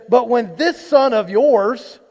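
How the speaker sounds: "this" pushed out high, loud and slow; stress on this son. Acted. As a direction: lean hard on "this son of yours" to push the blame away. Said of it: "like he's your problem, not mine".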